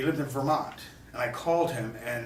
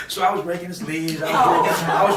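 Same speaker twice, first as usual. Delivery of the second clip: laughing; 5 semitones higher, 10 dB louder than usual. Real, not acted. Laughs about raking the host's leaves.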